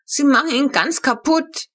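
put-on high, loud little girl's whine; a child complaining about the ruined toy; whining child. A narrator's reading. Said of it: "jammerte Flo".

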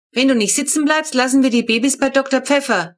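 put-on a father's stern threat to the kid; dad threatening the restless child in the car. A narrator's reading. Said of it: "drohte Papa".

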